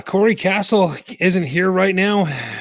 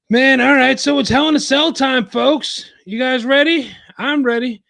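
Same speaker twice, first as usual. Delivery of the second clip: high, loud hype voice; hyped up; 10 semitones higher, 3 dB louder than usual. Real, not acted. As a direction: pump up the crowd. Hyping up the big event.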